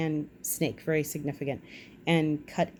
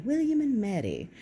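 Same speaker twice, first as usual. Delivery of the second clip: playful repeat; 6 semitones higher than usual. Shown as a demonstration.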